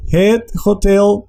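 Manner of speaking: slow "hotel" with H like Spanish J, stress on TEL; pronunciación holandesa. Shown as a demonstration. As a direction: model "hotel" slowly in Dutch, sounded H, second syllable stressed. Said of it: "se hace énfasis o entonación en la segunda sílaba". ("hotel" despacio, hache como jota, énfasis en TEL)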